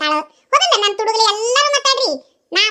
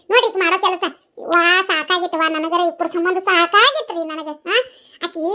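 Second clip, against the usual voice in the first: ordinary talk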